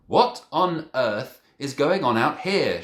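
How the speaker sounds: slow, loud stern demand. A narrator's reading. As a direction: demand an answer. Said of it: "she demanded".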